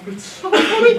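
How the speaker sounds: high, laughing through the words; words breaking into real laughter. Real, not acted. Laughing while telling the audience about his mom.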